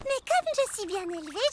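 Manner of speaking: normal talking voice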